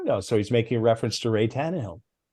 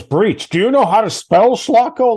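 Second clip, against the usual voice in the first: much louder, much higher mock yell; mock yelling boss; 11 semitones higher, 10 dB louder than usual. Boss Jim yelling at the proofreader over a typo, mock yell. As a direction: bellow it like an outraged boss. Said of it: "he was yelling at me over breach".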